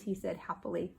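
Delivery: ordinary delivery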